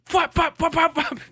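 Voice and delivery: high mock flop noises; high silly flop flop flops; flop sound effect. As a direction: bounce out each flop like a falling battery. Imitating batteries hitting the ground.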